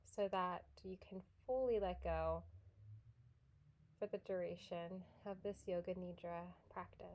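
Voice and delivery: soft, unhurried yoga nidra guidance with long pauses; guided meditation. Real, not acted.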